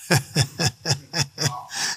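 soft rhythmic chuckle; laugh. Real, not acted. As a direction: chuckle to yourself in short, even bursts. Laughing at the devil's claim.

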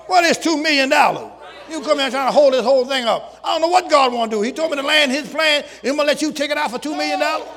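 loud, high, fervent preaching; preaching at full force. Acted. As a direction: drive it home like a preacher refusing a bribe. Preaching about refusing the bribe.

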